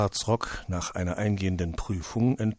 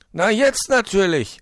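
high, loud and wide awake; reply bursting with pep; bright-eyed storybook fox voice; chipper fox; 12 semitones higher, 8 dB louder than usual. A narrator's reading. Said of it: "erwiderte er putzmunter".

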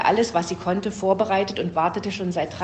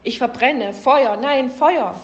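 high panicked scream, louder and higher; frantic shouts of fire, building at the end; screaming for help; 5 semitones higher, 6 dB louder, slower than usual. A narrator's reading. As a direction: cry out as if burning alive. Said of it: "jemand schrie".

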